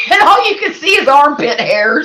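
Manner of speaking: laughing while telling it; giggling through the words. Real, not acted. Laughing at a funny photo.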